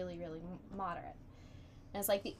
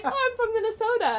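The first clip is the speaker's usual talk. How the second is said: 1 cheerful stranger; 10 dB louder, faster than usual. Retold or imitated.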